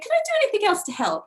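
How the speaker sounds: chirpy put-on Liverpool accent, fast and high; imitating Liverpudlian accent. Retelling the bank call, playing the Liverpudlian clerk, Liverpool accent. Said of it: "She had the most amazing Liverpudlian accent".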